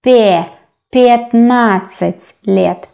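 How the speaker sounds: "пятнадцать" starting with э, not я, drawn out; careful syllables, "Пятнадцать" opening as "пэт"; reduced vowel. Shown as a demonstration. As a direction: isolate "Пе", then slowly say "Пятнадцать". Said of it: "We pronounce э".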